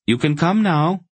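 high, lilting call to companions; woman's high-pitched summons. A narrator's reading. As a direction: call them over, pitch well up. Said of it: "she called to them finally".

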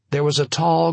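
plain reading voice

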